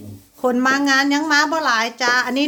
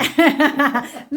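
quick amused cackle; real giggly outburst; burst of laughter. Real, not acted. Amused by seating of honoured guest.

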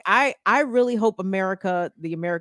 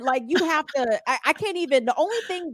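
usual speaking voice

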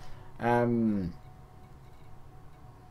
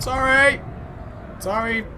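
startled apology; 11 semitones higher than usual. Real, not acted. Apologising after crash.

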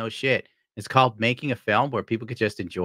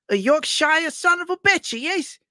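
very high, slow, hammed-up Yorkshire accent; mock Yorkshire accent; slower than usual. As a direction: exaggerate a Yorkshire accent, drawn out and pitched way up. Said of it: "he just starts going into this".

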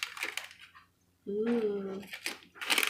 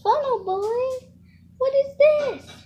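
calling out; 12 semitones higher, 11 dB louder, slower than usual. Acted. Child calls to funnel toy.

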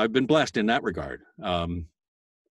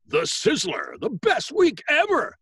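high, slow, over-the-top hype read; booming promo announcer; 13 semitones higher, 6 dB louder, slower than usual. Shown as a demonstration. Voice actor recalling his VH1 promo read, booming announcer.

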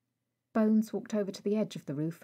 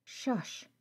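a breathy hush, barely voiced; 4 semitones higher, 3 dB quieter than usual. Bones quieting Rag on the ward, hushed. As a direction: shush him so no one hears. Hushing a friend in hospital.

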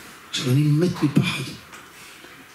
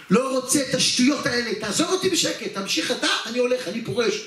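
frightened pleading; 6 semitones higher than usual. Retold or imitated. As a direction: voice climbing high in panic. Scared young man begging to quit.

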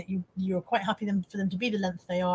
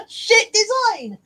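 slow, high, loud through laughter; wheezing out the verdict laughing; 11 semitones higher, 8 dB louder, much slower than usual. Real, not acted. Verdict on the mug, laughed out high and loud.

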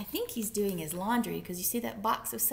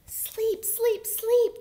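put-on high-pitched tired baby chorus; tiny high voices, punching each word; little babies pleading in high, loud chirps; 14 semitones higher, 8 dB louder, much slower than usual. A narrator's reading. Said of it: "the tired babies finally whispered".

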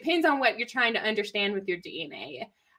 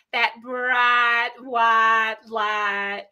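Arkansas accent; much slower than usual. Shown as a demonstration. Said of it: "Arkansas accent".

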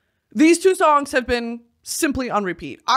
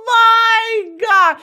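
high, loud, drawn-out swoon; overwhelmed wail; 12 semitones higher, 7 dB louder, much slower than usual. Real, not acted. Fan overcome by [name]'s lyrics, loud high wail. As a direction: stretch it into a slow wail.

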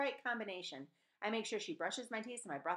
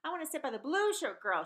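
higher, louder insistent demand; 7 semitones higher, 6 dB louder than usual. Acted. Maria demands to sit beside the blue shirt girl, louder. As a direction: insist on it, pushing harder. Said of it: "she demanded".

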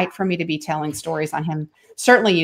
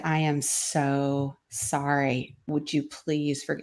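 soft, very slow and contrite; tearful apology; 3 semitones lower, 8 dB quieter, much slower than usual. Retold or imitated. Mother apologizing to her young son, soft and slow. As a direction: apologize from the heart, hushed.

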